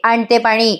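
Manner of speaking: ordinary talk, nothing marked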